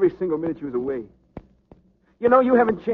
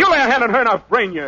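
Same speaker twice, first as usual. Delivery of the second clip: raised, higher threat at a rival; angry threat; 6 semitones higher, 5 dB louder than usual. Acted. Boxer threatening the rival who insulted his girl, loud and angry. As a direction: warn him off loudly, ready to swing. Danny threatens Murray in quarrel.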